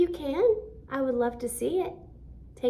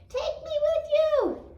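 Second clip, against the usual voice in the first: much higher, squeaky hen voice; talking hen; 5 dB louder than usual. Acted. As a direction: plead like a tiny hen. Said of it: "And it says".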